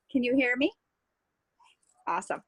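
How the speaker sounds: whispered question. Real, not acted. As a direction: ask it in a hushed whisper. Joining call, kids just put to bed.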